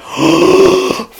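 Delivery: big high-pitched amazed gasp; mock gasp. Boys watching the cans explode, a high mock gasp. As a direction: gasp like you can't believe it.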